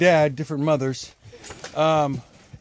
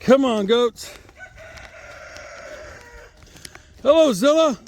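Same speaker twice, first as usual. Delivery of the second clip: higher-pitched call to the goats; calling animals; 9 semitones higher than usual. Real, not acted. Farmer coaxing goats to come and eat, calling out. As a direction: call out in a lifted voice.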